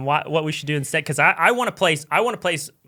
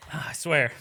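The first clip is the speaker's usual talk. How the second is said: ordinary delivery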